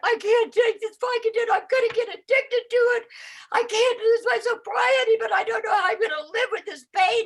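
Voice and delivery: high, loud mock wail; panicked whining. Patient calling sponsor about Vicodin, high hammed-up panic. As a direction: whine frantically, exaggerating your past panic.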